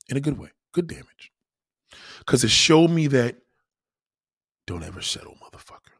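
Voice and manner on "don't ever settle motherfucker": soft emphatic whisper; whispered advice. Real, not acted. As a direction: lean in and whisper it like a secret. Punchline advice.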